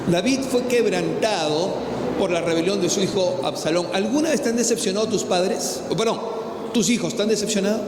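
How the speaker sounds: loud, driving sermon to the congregation; full-force preaching. Acted.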